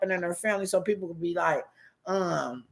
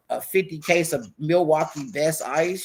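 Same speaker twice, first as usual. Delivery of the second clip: normal talking voice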